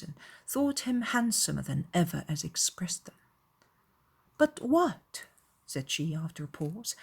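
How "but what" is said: higher voice, wondering emphatically; searching, troubled question; puzzled Elizabeth. A narrator's reading. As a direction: voice a perplexed young lady. Reader voices Elizabeth questioning Wickham.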